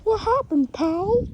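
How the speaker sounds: very high, loud cartoon voice; Mickey. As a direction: ask it in a high cartoon squeak. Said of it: "and then Mickey says".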